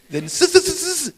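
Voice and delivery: high mock stutter on "s-"; a playful stutter; stammering. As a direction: catch on the first sound over and over. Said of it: "Moses was a stammerer".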